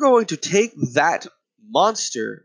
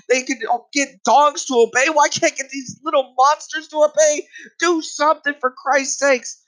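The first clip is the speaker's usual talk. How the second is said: pitched way up in exasperation; exasperated rant; 9 semitones higher than usual. Real, not acted. Ranter demanding parents discipline kids, exasperated. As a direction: plead and scold at a high pitch.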